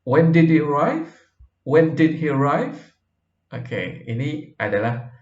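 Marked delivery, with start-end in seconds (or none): 0.0-1.1 "When did he arrive" with pitch climbing high on "arrive"; rising question intonation. Shown as a demonstration. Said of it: "nadanya naik". 1.6-2.8 "When did he arrive" with pitch climbing high on "arrive"; rising question intonation. Shown as a demonstration. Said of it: "nadanya naik".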